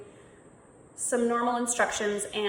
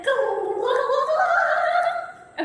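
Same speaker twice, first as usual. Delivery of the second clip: cheering them on; 6 dB louder than usual. Acted. Urging students to shake it out.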